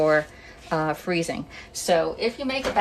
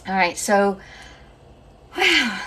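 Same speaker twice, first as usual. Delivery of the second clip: winded after grating potatoes; slightly out of breath; 2 semitones higher, 5 dB louder, slower than usual. Real, not acted. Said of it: "let me catch my breath".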